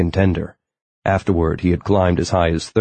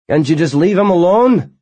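pleading call, much higher and louder; calling after; 12 semitones higher, 5 dB louder than usual. A narrator's reading. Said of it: "Tachyon called after him".

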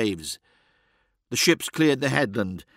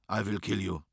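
quiet menace; 4 semitones lower, 8 dB quieter than usual. Acted. Azazel threatens the boy.

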